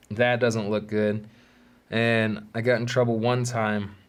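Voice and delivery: ordinary talk, nothing marked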